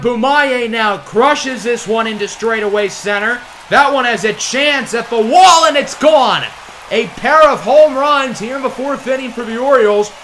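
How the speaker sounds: loud, high shout as it leaves the park; excited home run call. Real, not acted. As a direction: let the crowd feel it go out.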